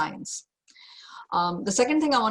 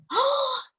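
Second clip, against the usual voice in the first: sharp, high, panicky gasp; panicked gasp; 3 dB louder than usual. Acted. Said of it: "scarcity is always loud and shrill and shrieking".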